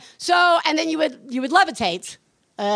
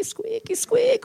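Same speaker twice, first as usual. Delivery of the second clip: imitated squeak; 12 semitones higher, 3 dB quieter, much slower than usual. Shown as a demonstration. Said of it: "imitating the bed".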